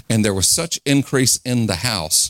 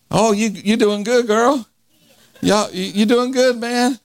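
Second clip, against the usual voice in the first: much higher, squeaky mocking church lady; 12 semitones higher than usual. Preacher imitating people remarking on his age, high mock voice. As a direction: pipe it up like a teasing old lady.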